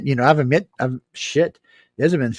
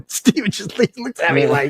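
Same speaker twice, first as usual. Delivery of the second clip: laughing; 9 semitones higher than usual. Real, not acted. Laughing while retelling.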